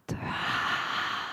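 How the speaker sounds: long soft sigh emptying the lungs; teacher demonstrating a full exhale sigh; breathy exhale sigh. Shown as a demonstration. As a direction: push all the air out in a sigh. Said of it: "Extract all the breath out".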